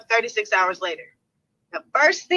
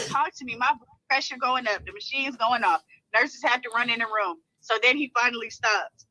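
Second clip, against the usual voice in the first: ordinary talk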